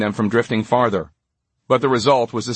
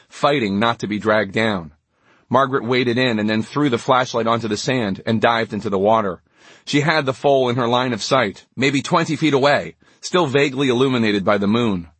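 ordinary delivery

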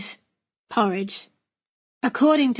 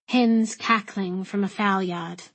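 ordinary delivery

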